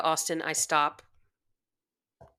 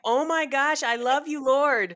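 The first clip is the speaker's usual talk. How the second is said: high, laughing gush of love; mock devout swooning to the Lord; gushing devotion; 8 semitones higher, slower than usual. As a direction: swoon over the Lord, giggling. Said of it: "I was like".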